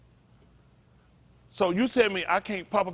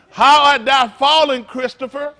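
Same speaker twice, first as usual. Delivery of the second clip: mock solemn scripture; 10 semitones higher, 11 dB louder, slower than usual. Mock scripture teasing a churchgoer.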